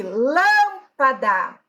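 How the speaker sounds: LÂMPADA with a long, punched LÂM; stress on LÂM. Shown as a demonstration. Teacher giving an example, lâmpada with LÂM drawn out and stressed. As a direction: stretch the first syllable of lâmpada, then let PADA follow. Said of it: "só vai até a terceira sílaba".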